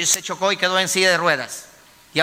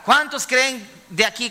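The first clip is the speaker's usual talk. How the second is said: normal talking voice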